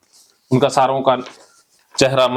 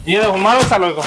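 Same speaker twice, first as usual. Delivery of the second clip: high-pitched, relentless egging-on; fired-up therapy drill leader; heated cathartic incitement; 6 semitones higher than usual. Acted. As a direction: goad them to attack the imagined enemy. Incites group to show no mercy.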